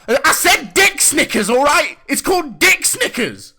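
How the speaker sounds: very high, loud forced repetition; exasperated shouting. Acted. Trade recipient forced to repeat the nickname, exasperated shout. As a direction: yell it out, fed up with repeating.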